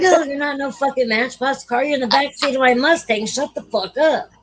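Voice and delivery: high-pitched retold telling-off; worked-up mock rant, pitched high; exasperated scolding. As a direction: act out a fed-up driver setting someone straight. Driver scolds tripping friend.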